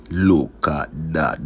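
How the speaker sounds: drawn-out slow catchphrase. Acted. Cook showing viewers the dish, slow catchphrase. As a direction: stretch it out proudly.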